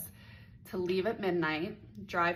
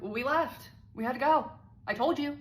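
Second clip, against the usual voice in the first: a touch higher and louder dismissive guy; mock dismissive; 7 semitones higher, 5 dB louder than usual. He dismisses her arrival, mildly higher and dismissive. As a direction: brush her off lightly. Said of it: "He's like".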